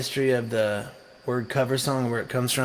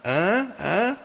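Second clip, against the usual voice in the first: playful agreeing grunts; 8 semitones higher, slower than usual. Retold or imitated. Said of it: "I was like".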